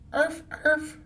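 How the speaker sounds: high yappy little dog; playful dog yap; dog barking. Acted. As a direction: bark like a small dog. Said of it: "barked Wiggles".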